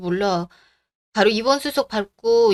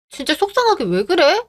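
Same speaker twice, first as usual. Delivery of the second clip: upset outburst; 8 semitones higher than usual. Acted. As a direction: snap at mother out of worry. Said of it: "얘는 왜 이렇게 화를 내?".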